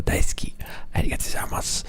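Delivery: whispered Japanese thanks; soft whisper to the stream. Pretend. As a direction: breathe the thanks, unvoiced.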